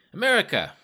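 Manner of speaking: high, loud announcement; loud translation. A narrator's reading. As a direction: proclaim it to the whole carriage. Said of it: "The man translated loudly".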